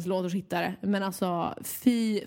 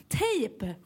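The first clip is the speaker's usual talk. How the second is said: "Tejp" said alone, raised pitch, falling at the end; a firm, isolated "Tejp"; emphatic repeat; 4 semitones higher than usual. Retold or imitated. Care worker tells old lady the word, emphatic "Tejp".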